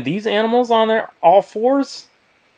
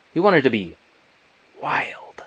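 usual speaking voice

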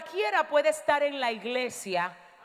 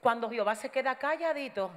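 ordinary talk

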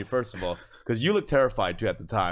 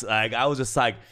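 ordinary talk

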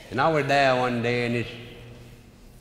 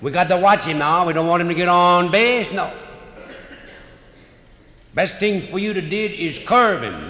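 urgent call; 6 semitones higher, 6 dB louder than usual. Retold or imitated. Catcher urging pitcher, tense.

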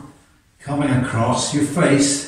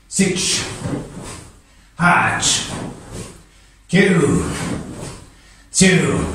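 sharp martial arts commands; 6 semitones higher, much slower than usual. Real, not acted. Karate count in class.

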